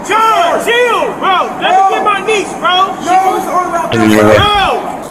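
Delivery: frightened shouting. Real, not acted. Frightened man shouting at police.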